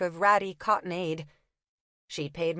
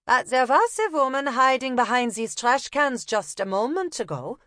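put-on higher, louder German-accented call; 5 semitones higher, 4 dB louder than usual. Una calling the leaving copper back, German accent. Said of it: "feigning a German accent".

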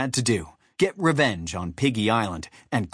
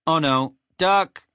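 slow, raised warning; higher, urgent heads-up; 5 semitones higher, 4 dB louder, slower than usual. A narrator's reading. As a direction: call out a drawn-out warning. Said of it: "he warned them".

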